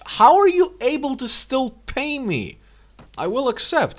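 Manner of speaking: high baffled disbelief; incredulous. Real, not acted. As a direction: sound amazed they can still pay. Baffled at broke clan paying.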